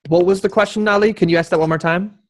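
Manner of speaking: ordinary talk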